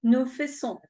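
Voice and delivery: "Nous faisons" with a silent S and a nasal last syllable. Shown as a demonstration. Teacher models the nasal ending for the class, careful nasal vowel. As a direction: end "faisons" in the nose, no S. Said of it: "es nasal".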